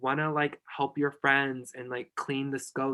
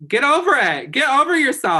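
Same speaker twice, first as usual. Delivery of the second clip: loud, high, exasperated push; emphatic shout; 12 semitones higher, 11 dB louder than usual. Real, not acted. Friend urging a nervous host, loud and high. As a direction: shout it to snap her out of it. Hyping friend to stop self-doubt.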